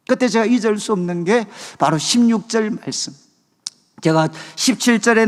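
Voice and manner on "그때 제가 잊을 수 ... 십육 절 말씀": normal talking voice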